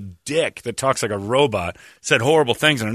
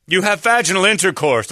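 blunt doctor; 7 semitones higher, 4 dB louder than usual. Pretend. Voicing the rude doctor.

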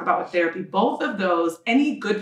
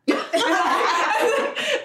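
a real, high, bright laugh breaking out; sudden high laugh; high burst of laughter; 12 semitones higher than usual. Real, not acted. Laughs after therapy joke.

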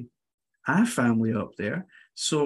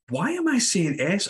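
high, exasperated mock outburst; a half-hearted yell at his own recording; mock exasperated shout; 8 semitones higher than usual. Yelling at his own recording, half-mock exasperation. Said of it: "I remember shouting at my screen".

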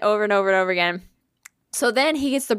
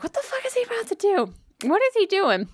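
high, incredulous questioning; retold bewilderment; 11 semitones higher than usual. Child wonders what dad is about to do, bewildered. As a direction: replay your alarmed inner voice, pitched way up. Baffled reaction to dad's odd behaviour.

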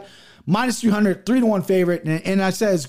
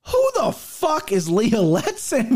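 slow, drawn-out incredulous question; baffled disbelief; 6 semitones higher, much slower than usual. Retold or imitated. Recalling his reaction to the unknown underdog, slow stunned disbelief. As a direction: stretch it out like you can't believe the name. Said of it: "was what I said to myself".

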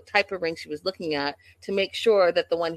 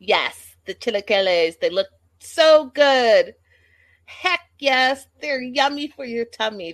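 louder, slow, savoring each word; excited gushing; 5 semitones higher, 6 dB louder than usual. Real, not acted. To live viewers about the chilaquiles, loud slow gushing. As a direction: drool over the chilaquiles, drawn out. Gushing over the food on screen.

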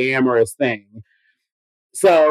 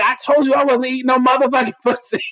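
raised, angry yell at him; retold yelling; 7 semitones higher than usual. Woman yelling at her hookup in the street.

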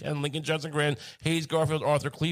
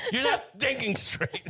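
laughing tease; 13 semitones higher, slower than usual. Real, not acted. Laughing teasing.

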